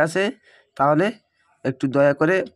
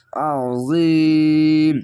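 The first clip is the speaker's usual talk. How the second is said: very long, louder chanted Azim held for three alifs; long recitation; 4 dB louder, much slower than usual. Shown as a demonstration. Teacher showing the three-alif stretch, long loud chant. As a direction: sustain the ee in Azim fully. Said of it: "তিন আলিফ টেনে পড়বেন".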